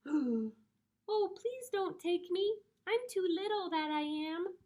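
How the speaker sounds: high, tiny pleading voice; high-pitched little storybook creature; little billy goat. A narrator's reading. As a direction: plead like a small, frightened goat. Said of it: "said the billy goat".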